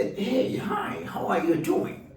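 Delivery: mock dad putting on a sweet show for the camera; fake camera-ready husband. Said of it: "as if they, they were on camera".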